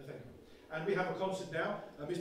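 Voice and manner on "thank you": a faint whisper from away from the mic; barely there, off the mic; far-off whispered thanks. Shown as a demonstration. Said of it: "enact a fading motion".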